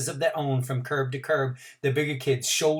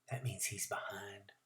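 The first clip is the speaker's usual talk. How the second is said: whisper; 5 semitones lower, 18 dB quieter than usual. A narrator's reading. Narrator's hushed aside.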